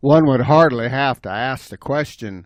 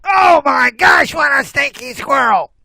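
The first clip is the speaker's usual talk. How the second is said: disgusted shout; 11 semitones higher, 5 dB louder than usual. Acted. Bobcat smelling the squirrel, high loud disgust. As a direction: cry out in hammed-up horror. Bobcat hit by stench again.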